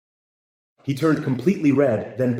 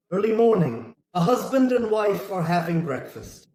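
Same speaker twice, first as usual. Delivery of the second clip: ordinary talk, nothing marked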